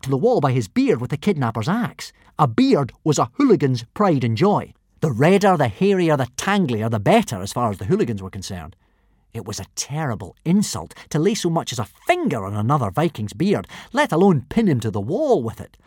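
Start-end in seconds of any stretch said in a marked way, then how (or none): none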